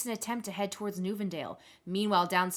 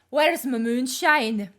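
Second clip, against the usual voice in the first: put-on demanding drunk Grena; 3 semitones higher, 8 dB louder, much slower than usual. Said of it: "So she makes it to you".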